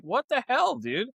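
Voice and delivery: high, shocked what the hell; incredulous outburst. Retold or imitated. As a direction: squeak out disbelief at a friend. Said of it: "It's like".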